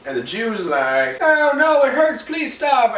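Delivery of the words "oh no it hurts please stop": mock pained pleading.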